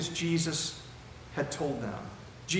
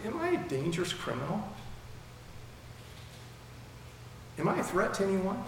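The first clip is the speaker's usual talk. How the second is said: mildly puzzled question, slightly higher voice; not snarky, not testy; 5 semitones higher, 6 dB quieter, faster than usual. Acted. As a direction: ask it innocently, without any edge. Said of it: "he's not snarky, he's not testy".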